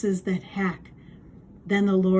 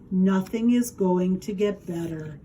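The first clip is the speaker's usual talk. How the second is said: ordinary delivery, nothing marked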